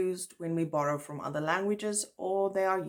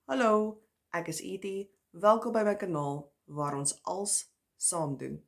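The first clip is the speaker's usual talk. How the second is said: switching into native Afrikaans; Afrikaans greeting; 3 semitones higher than usual. Shown as a demonstration. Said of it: "My first language is Afrikaans".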